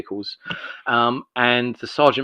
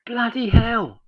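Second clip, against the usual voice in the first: retold astonishment; 13 semitones higher, 4 dB louder, much slower than usual. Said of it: "It's like".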